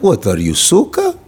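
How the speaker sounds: low-pitched Russian tough guy; mock Russian accent. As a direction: scold in a hammed-up deep Russian accent. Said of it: "especially you motherfuckers".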